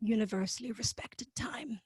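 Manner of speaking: whispered aside; whispering. Real, not acted. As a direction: drop to a whisper. Joking aside about drinking.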